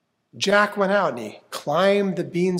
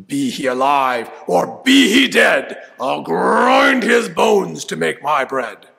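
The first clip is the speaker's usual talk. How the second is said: booming giant; 3 semitones higher, 8 dB louder than usual. Acted. Giant bellowing to smell out Jack, loud booming voice. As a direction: thunder it out like a hungry giant. Giant's chant third time.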